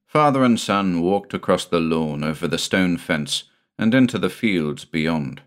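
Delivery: ordinary delivery, nothing marked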